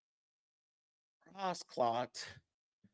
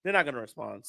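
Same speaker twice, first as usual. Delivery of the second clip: resigned mutter; 8 semitones lower, 8 dB quieter than usual. Retold or imitated. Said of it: "and I'm like".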